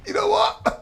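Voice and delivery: squeaky high voiced Spanish guy; a jokey high pitched quip; high mock Spanish guy. Spanish guy announces his plan, squeaky high. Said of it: "The Spanish guy said".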